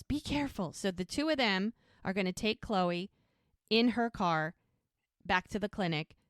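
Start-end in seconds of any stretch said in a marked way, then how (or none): 0.0-0.7 retold yell. Mel yells to Jack as they part, retold yell. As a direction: call a warning in a raised pitch. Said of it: "Mel yells".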